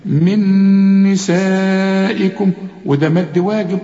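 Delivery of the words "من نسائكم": من نسائكم with the ن held long and nasal; ghunna recitation. Shown as a demonstration. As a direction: hum the doubled ن in من slowly.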